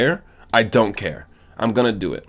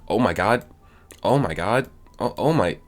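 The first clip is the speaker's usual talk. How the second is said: ordinary delivery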